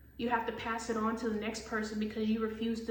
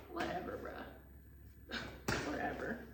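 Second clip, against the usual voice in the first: slow, unvoiced whatever; whispered dismissal; 9 dB quieter, slower than usual. Real, not acted. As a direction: brush it off in a whisper. Dismissing the boss.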